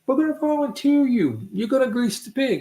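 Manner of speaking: high-pitched pushy announcement; volunteer him in a high voice; insistent old boy. Retold or imitated.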